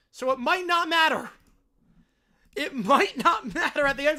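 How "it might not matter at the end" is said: laughing mid-sentence. Real, not acted. Fan laughing that the call might not matter, laughing. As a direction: let a laugh break through the words.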